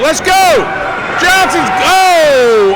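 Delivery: high voice, stretching into a long "oh"; wrestling announcer swept up in action; excited rallying call. Real, not acted. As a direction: urge the comeback on. Calling a comeback attempt.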